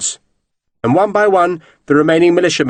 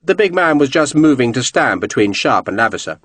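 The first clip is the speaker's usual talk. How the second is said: ordinary delivery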